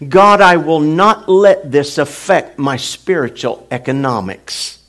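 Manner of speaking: loud preaching prayer; fervent prayer. Real, not acted. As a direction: declare it with fervor. Prayer at full force.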